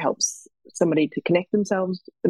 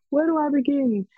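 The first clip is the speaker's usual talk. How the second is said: lost and unsure; 10 semitones higher than usual. Retold or imitated. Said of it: "people might just open a page and be like".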